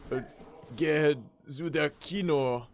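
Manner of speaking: slow, drawn-out German at normal pitch; German speaking style. Shown as a demonstration. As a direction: plod through it in stretched-out German. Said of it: "there's only two ways to speak German".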